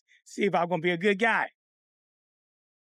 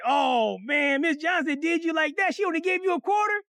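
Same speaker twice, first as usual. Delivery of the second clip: incredulous friend; 6 semitones higher than usual. Acted. Chucky sees the quarter in his hand, incredulous. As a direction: stretch it out, pitched up in mock shock.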